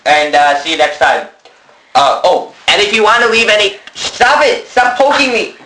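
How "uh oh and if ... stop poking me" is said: high, loud shout to stop; exasperated poked game character. Real, not acted. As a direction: snap at someone poking you. Being poked during sign-off.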